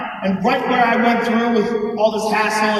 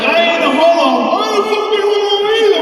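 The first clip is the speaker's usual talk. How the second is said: government men at a meeting, yelling a mock-macho denial; macho mock shout; 8 semitones higher than usual. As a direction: shout it like an insecure tough guy.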